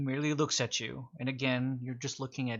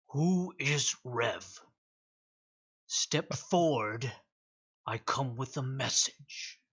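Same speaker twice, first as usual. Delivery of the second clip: ominous beast voice; slower than usual. Acted. Beast speaks to party.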